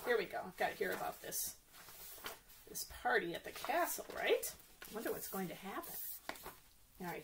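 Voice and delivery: ordinary delivery, nothing marked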